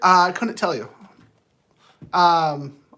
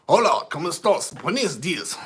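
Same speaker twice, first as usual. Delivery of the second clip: Spanish; 3 semitones lower than usual. Pretend. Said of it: "How Southern do you wanna go".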